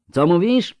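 high, loud call back over wind; shouted question. A narrator's reading. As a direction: ask as if you can't hear through the gale. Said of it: "przekrzykując wichurę". (wykrzyczane pytanie przez wiatr)

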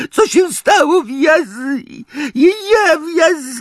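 very high, crying elf voice with shouted bursts; sobbing house-elf. A narrator's reading. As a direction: wail it through tears, squeaky and desperate. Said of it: "Zaniósł się takim szlochem".

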